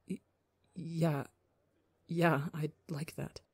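soft wavering voice, almost breaking; wavering murmur. Acted. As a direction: murmur it shakily and quietly. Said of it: "Murmurs out a wavering".

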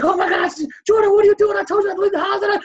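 high-pitched, rapid, loud scolding; panicked yelling. Acted. Nurse yelling at patient who left the hospital, panicked yelling. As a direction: shriek it like an alarmed nurse. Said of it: "They're all yelling and shit".

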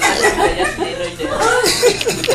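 put-on high, childish back-and-forth; mock kid bickering, high. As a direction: squabble like a five-year-old. Acted father-child argument.

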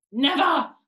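loud, sharp outburst; cried denial. A narrator's reading. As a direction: cry out a flat refusal. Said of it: "she cried".

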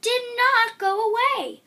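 loud, high, slow punchline. A narrator's reading. Narrator to listeners, the twist landed loud and slow. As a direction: land the twist slowly.

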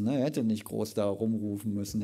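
ordinary delivery

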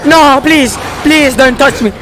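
high, loud begging in fright; real scared begging, high and loud; frightened pleading. Real, not acted. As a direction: plead not to be touched. Creature grabbing him in game.

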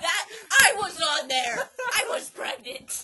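very loud, high mock wail; drawn-out mock-distraught shout; hammed-up outburst. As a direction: wail it slowly, playing it up. Hammed-up outburst about teen show.

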